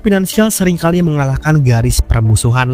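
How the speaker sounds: loud, steady documentary voiceover; documentary narration. Acted. Documentary narration over music.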